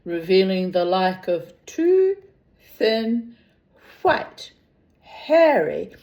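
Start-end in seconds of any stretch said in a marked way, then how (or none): none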